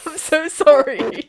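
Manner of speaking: loud, high, drawn-out sorry; cringing apology. Real, not acted. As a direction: wail the apology, mortified at yourself. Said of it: "I'm cringing at myself".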